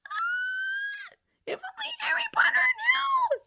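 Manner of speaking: very high anguished wail; an elf groaning in despair. A narrator's reading. Dobby lamenting to Harry, high anguished groan. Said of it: "Dobby groaned".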